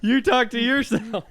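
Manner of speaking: loud, high, drawn-out jab; teasing outburst. Real, not acted. Friend teases the storyteller about his brother, loud teasing. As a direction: blurt it out loudly in mocking disbelief.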